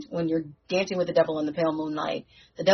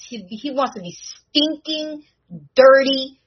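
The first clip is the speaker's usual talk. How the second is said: higher, with dirty and filthy spat out loud; mock sneering villain, pitched up; poisonous villain accent; 7 semitones higher, 5 dB louder, slower than usual. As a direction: savour the greed like a nasty villain. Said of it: "he said it with the most, horrible, poisonous accent".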